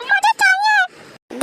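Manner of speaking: put-on high, slow, drawn-out toddler whine; whiny little kid. Toddler whining at his sister for the biscuit. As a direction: stretch it out like a small child demanding a treat.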